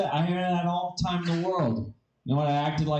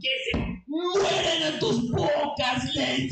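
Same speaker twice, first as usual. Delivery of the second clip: grandpa cursing in Spanish; 13 semitones higher than usual. Acted. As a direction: scream damnation at them, stretching the words. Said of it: "he curses them out in Espanol".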